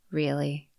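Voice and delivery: flat, low, bored "Really?" like Ben Stein; deadpan and unimpressed; monotone. Shown as a demonstration. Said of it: "say it in a monotone way, like Ben Stein".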